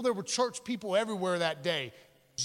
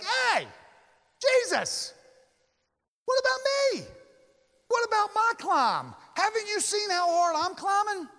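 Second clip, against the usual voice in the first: much higher, louder, whiny complaining voice; indignant churchgoer; 12 semitones higher, 4 dB louder than usual. Retold or imitated. Jealous church person protesting to Jesus, whiny and high. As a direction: play the jealous climber crying foul at Jesus. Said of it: "you'd be like".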